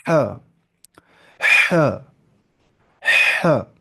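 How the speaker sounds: repeated "ہاں" as "haa", each opening on a long forceful breath; mid-throat ha. Shown as a demonstration. Teacher training the throat to make the sound, ha drill. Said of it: "مجھے وہاں سے آواز نکالنی ہے".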